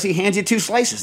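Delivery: usual speaking voice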